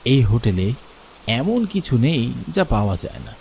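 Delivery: usual speaking voice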